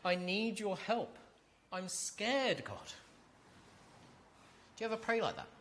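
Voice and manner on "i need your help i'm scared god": slow, higher, earnest plea for help; a frightened child asking God for help; a gentle, pleading prayer. Pretend. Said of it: "You run to him and say".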